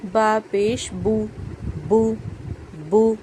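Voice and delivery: बा पेश बू spelled out, बू with a pure oo; slow syllable drill. Shown as a demonstration. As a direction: drill बा पेश बू slowly, like a Quran teacher. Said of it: "ना ही ज़्यादा हमें लंबा करना है".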